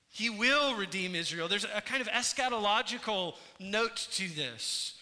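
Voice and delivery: full-force preaching. Acted. Preacher proclaiming redemption to the congregation, impassioned preaching. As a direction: proclaim plentiful redemption like a rising sermon.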